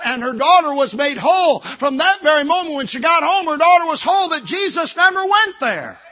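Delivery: rising pulpit fervour, stressing NEVER; full-force preaching. Acted. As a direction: preach it out to the whole church. Preacher climaxes the story.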